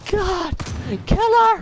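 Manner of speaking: over-the-top panicked shriek; hammed-up scream. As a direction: yell it like a horror movie victim.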